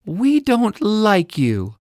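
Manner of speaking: put-on high, slow little-girl voice; girl's voice. Ella telling Parrot off, high slow girl voice. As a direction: say it slowly like a cross little girl. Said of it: "Ella says".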